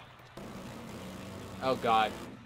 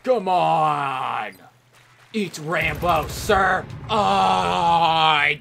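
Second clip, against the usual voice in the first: hammed-up battle yell; 3 semitones higher, 6 dB louder, much slower than usual. Fighting loudly.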